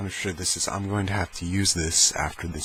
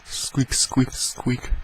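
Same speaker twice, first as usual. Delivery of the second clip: higher-pitched imitated squeaks; mouse squeak; 6 semitones higher, much slower than usual. Imitating the noise for listeners, mouse-like squeak. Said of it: "It sounds like a mouse".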